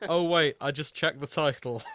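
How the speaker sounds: ordinary talk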